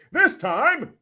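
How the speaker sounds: high, odd mock exclamation; weird delivery. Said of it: "You don't talk like that".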